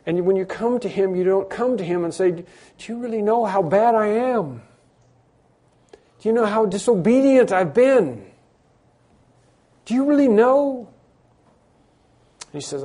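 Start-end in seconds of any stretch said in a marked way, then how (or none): 2.3-10.9 higher, louder, insistent questions; incredulous pleading. Acted. A sinner coming to Christ, pleading urgently. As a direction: plead with rising urgency to be believed.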